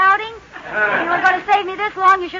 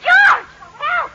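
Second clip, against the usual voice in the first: alarmed shout; 8 semitones higher than usual. Acted. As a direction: call out to the fallen husband. Wife calling for help.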